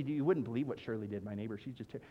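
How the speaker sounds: low, hushed gossip about the neighbor; gossipy. Pretend. Churchgoer gossips about neighbor Shirley in a low hush. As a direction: lean in and drop low to dish.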